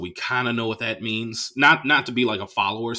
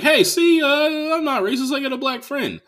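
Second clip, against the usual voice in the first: much higher, chirpy voice; 4 dB louder than usual. Retold or imitated. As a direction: play a guy proud of his token friend. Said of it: "he goes on his joke to say".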